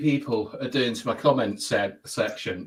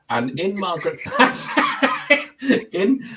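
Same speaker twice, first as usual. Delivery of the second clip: breaking into laughter; 5 semitones higher, 4 dB louder than usual. Real, not acted. Host begins a Thatcher analogy to Mister B, breaking into laughter. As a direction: laugh bursts through the name.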